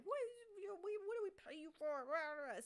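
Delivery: high, fast bar-owner rant; hammed-up ranting that tumbles into gibberish; mock angry yelling. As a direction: imitate an irate bar owner going off. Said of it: "on the street yelling".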